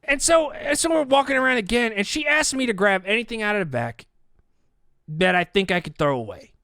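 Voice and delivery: ordinary delivery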